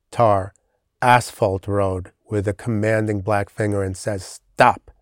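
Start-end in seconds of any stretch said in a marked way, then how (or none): 4.2-4.9 "Stop." as a short, sharp, falling push, staccato; falling fourth tone. Shown as a demonstration. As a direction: jab "Stop." down hard and short like a Chinese fourth tone. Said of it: "The sound of the final tone is a short staccato push down".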